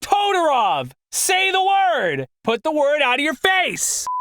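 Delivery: high, real exasperation; frustrated yell. Real, not acted. Narrator scolding himself over one word, frustrated yell. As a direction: yell it at yourself, pitch jumping up and falling.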